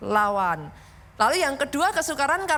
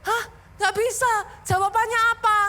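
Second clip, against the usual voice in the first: put-on higher-pitched kid giving up; stumped child; 7 semitones higher than usual. Child asks parent for the answer, lightly mimicked helplessness. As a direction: lightly imitate a child who can't work it out. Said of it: "dia masih gini".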